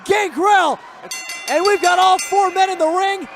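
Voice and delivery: loud, high excited ring call; wrestling commentator. Real, not acted. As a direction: call the brawl like it's chaos. Excited live call of brawl.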